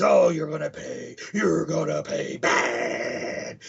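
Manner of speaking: very slow, dragged-out villain threat; mock threat. Ned swearing revenge on Flash, very slow and hammed-up. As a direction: stretch every word like a cartoon vow of revenge.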